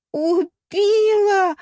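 high, loud, very drawn-out wail; стонет. A narrator's reading. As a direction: moan it like a melodramatic death scene. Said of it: "простонала русалка". (театральный протяжный стон)

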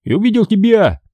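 usual speaking voice

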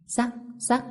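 high, soft crunch noises; imitated crunching. A narrator's reading. Girl bites the lotus, high soft crunching noises. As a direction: voice the bites as little cracks.

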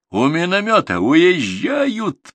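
ordinary delivery

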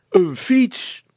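high, loud exclamation; shouted answer. A narrator's reading. Father and son answer the puzzle at once, shouting. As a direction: blurt it out loudly. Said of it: "riepen Brilstra en zijn zoon tegelijkertijd".

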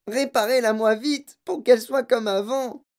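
high, forceful plea; indignant demand. A narrator's reading. Mother demanding daughter be repaired, indignant. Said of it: "hurla madame Beauregard".

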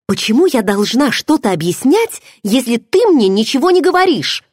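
angry protest rising to a near shout; angry retort. A narrator's reading. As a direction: fire the question back in anger. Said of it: "Я зло смотрела на него".

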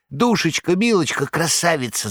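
high, wheedling Korovyev; wheezing flattery. A narrator's reading. Korovyev winking over the counter at the saleswoman, high wheedling flattery. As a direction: sweet-talk her with a wink. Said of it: "засипел Коровьев".

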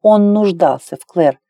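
ordinary delivery, nothing marked

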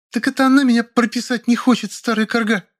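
very high, vicious accusation; shrill spite. A narrator's reading. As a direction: spit it at the old woman. Woman squabbling in hallway. (злобный визг)

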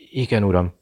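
usual speaking voice

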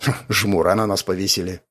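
brief scoffing "hm", then a mild grumble; snorting disgruntlement. A narrator's reading. Andrei reacts to being blamed for a body, mildly scornful. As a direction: snort it, understated. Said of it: "фыркнул Андрей".

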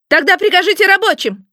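high-pitched squealed command; piercing squeal. A narrator's reading. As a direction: squeal it like an outraged little boss. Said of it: "взвизгнул пронзительно шеф".

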